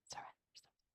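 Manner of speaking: breathy whisper of a single word, Surrender; whispered cue. Shown as a demonstration. Guiding the listener to let go, whispered.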